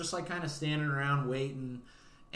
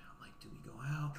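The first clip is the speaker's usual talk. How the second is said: whispered doubt; 13 dB quieter, much faster than usual. Retold or imitated. Retold doubt before going out.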